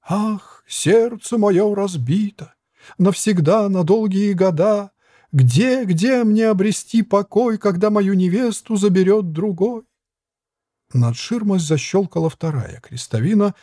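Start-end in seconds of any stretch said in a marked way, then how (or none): 0.0-9.8 high, slow tragic wail; tragic lament. A narrator's reading. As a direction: wail over a broken heart. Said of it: "трагически".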